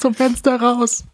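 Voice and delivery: high, slow, pleading; mock desperate plea.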